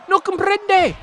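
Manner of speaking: put-on cheesy Spanish-accented yell; mock Spanish. Mock Spanish during wrestling game.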